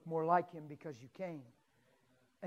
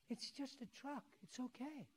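soft, higher-pitched comforting voice; soothing reassurance; 6 semitones higher, 15 dB quieter than usual. Retold or imitated. Preacher retelling how he calmed shaken grandma, soothing. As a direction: gently talk someone down.

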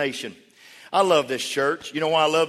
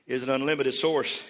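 ordinary talk

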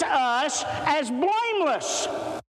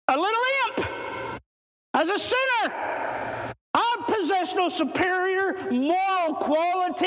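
raised, ringing preacher's voice; fervent sermon delivery, pitched up; preaching; 6 semitones higher than usual. Acted. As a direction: lift it like a pulpit testimony.

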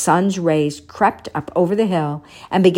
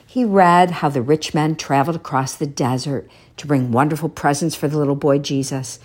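usual speaking voice

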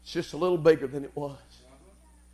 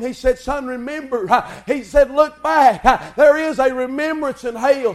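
loud, rhythmic revival preaching with gasped breaks; full-force preaching; 7 semitones higher, 9 dB louder than usual. Real, not acted. Preacher rallying the church about Christ's return, full-force preaching. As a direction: preach in driving, punched phrases.

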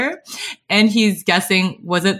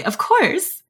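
happy; 9 semitones higher than usual. A narrator's reading. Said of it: "Shaleon replies happily".

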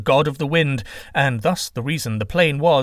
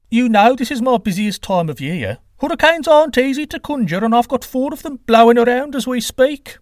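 high, loud character voice; put-upon god making loud excuses; defensive wind god; 12 semitones higher, 6 dB louder than usual. Acted. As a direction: protest your busy schedule, pitched well up.

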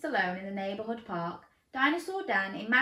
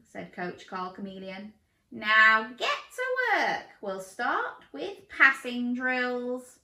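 higher, slow, friendly coach voice; coach chameleon voice; 8 semitones higher, 5 dB louder, slower than usual. Acted. Coach introduces himself to Dan in a slow voice. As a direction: play the enthusiastic coach.

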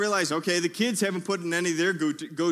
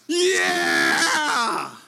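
higher, louder victory yell like a boxing champ; triumphant shout; 8 semitones higher, 7 dB louder than usual. Retold or imitated. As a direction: roar it like Rocky after the fight. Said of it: "I'm like Rocky Balboa".

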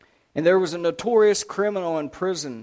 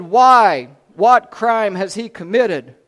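loud, forceful, raised questions; demanding; 3 semitones higher, 10 dB louder, slower than usual. A narrator's reading. Pilate challenging the crowd a third time, demanding. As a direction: demand it loudly, drawing the words out. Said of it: "the third time he demanded".

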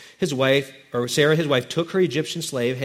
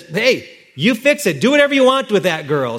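dismissive husband; 6 semitones higher, 5 dB louder than usual. Acted. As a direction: wave her problem away.

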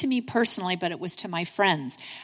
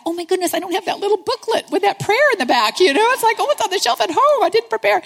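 high, loud mock panic, rushing as it goes; panicked; 10 semitones higher, 6 dB louder than usual. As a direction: blurt it out in a hammed-up panic. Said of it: "And then I panicked".

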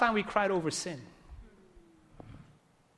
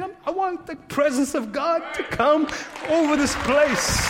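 loud, higher, desperate cry to God; shouted prayer; 7 semitones higher, 5 dB louder than usual. Retold or imitated. Crying out to God on a walk, loud pleading shout. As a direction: shout it up to heaven.

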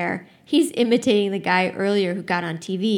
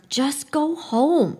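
slow, higher and louder, spelling out each word; firm plea; 6 semitones higher, 4 dB louder, much slower than usual. A narrator's reading. Older man begging the rioters to leave, slow firm plea. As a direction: plead firmly with the crowd. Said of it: "he says".

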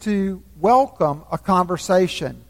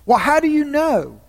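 put-on higher, wondering boy's question; puzzled boy; 7 semitones higher than usual. A narrator's reading. Shasta asks the voice, puzzled and high. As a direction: ask it high and bewildered.

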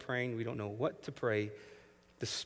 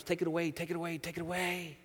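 pleading prayer; 5 semitones higher, faster than usual. Retold or imitated. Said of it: "he prayed to God three times".

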